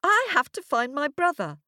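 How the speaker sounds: put-on high, insistent child voice; stamping little girl. A narrator's reading. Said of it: "stamping both her feet".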